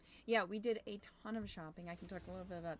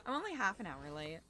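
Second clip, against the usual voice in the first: ordinary talk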